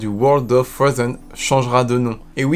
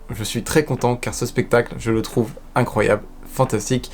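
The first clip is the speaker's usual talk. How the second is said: normal talking voice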